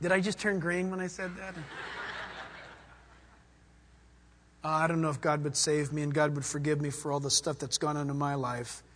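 a doubting sinner wondering if God saves him, timid; timid doubter. Acted. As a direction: mutter doubts about being forgiven. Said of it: "voicing a doubting listener".

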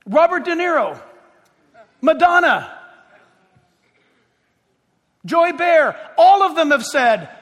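high, loud pulpit roll call; indignant preaching. Acted. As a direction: fire off the names like accusations. Calls out list of names.

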